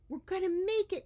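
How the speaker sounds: high, loud hopeful shout; excited cry. Acted. Wiggleskin running to the sea, excited. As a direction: cry out in hope. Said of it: "cried one Wiggleskin".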